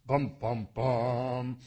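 low, loud "bum, bum, bum"; dun-dun-dun style vocal flourish; mock dramatic sting. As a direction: voice a suspense sting by mouth. Dramatic sting during scripture reading.

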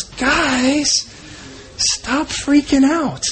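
high, slowed soothing plea; Jesus calming disciples. Acted. Jesus urging scared disciples to calm down, high and slow. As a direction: gently settle the frightened disciples. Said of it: "he looks and he goes".

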